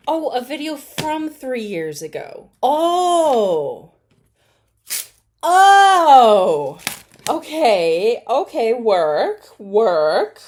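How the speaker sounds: high, loud building cheers of "okay, work"; cheering the clapback on; rising excited shouts; hyped up. Real, not acted.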